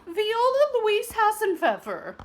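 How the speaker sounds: high, loud scolding call of her name; stern calling out. Acted. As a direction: call her out across the room. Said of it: "called her teacher".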